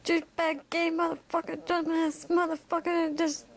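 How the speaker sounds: high-pitched ranting character voice; high, aggrieved tirade; Great One. Acted. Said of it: "And he goes".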